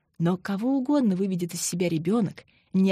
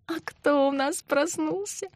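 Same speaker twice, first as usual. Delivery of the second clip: put-on whispered, higher slow baby talk; cooing baby talk; 8 semitones higher than usual. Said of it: "Засюсюкала, акая".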